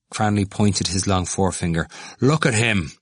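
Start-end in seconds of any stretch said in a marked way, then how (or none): 2.2-2.9 higher-pitched scorn; scornful. A narrator's reading. Cranley pointing him out to the others, scornful. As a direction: hold him up for ridicule. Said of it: "he said with scorn to the others".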